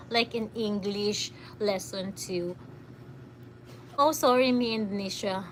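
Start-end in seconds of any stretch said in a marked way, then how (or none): none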